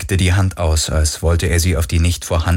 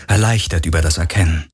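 ordinary delivery

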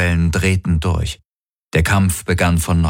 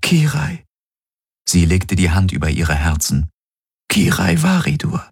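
Kirai, tearful. A narrator's reading. Kirai says her name.

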